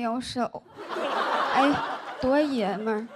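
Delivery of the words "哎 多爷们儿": ordinary delivery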